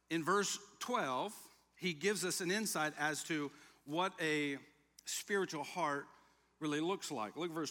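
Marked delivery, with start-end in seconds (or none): none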